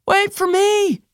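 high voice, muffled; called out from under bodies. Acted. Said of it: "came a muffled voice".

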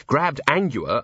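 ordinary delivery

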